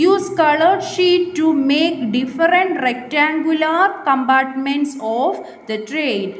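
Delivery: put-on very slow, clear reading for young pupils; slow read-aloud. Shown as a demonstration. Teacher reads the craft instructions to pupils, very slowly. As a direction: read each word out slowly so children follow.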